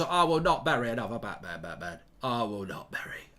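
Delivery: low, hushed Cockney impression, fading away; Michael Caine. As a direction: sink into a soft mock-emotional Cockney plea. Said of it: "I can't do Michael Caine".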